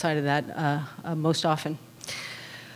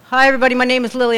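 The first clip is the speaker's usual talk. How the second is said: bright greeting; 6 semitones higher, 6 dB louder, much faster than usual. Real, not acted. Greets the room at her share.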